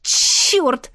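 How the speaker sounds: frustrated curse. Acted. Amulet tangled, frustration.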